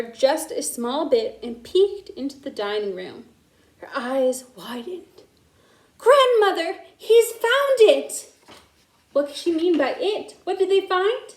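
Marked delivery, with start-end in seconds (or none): 6.0-8.3 much higher, louder cry; alarmed. A narrator's reading. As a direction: shout the alarm to grandmother. Maggie discovers the theft.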